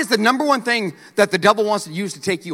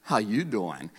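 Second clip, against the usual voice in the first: smooth flirt to the mirror; 10 semitones lower, 9 dB quieter, slower than usual. Retold or imitated. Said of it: "you're like".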